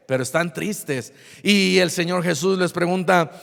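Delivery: usual speaking voice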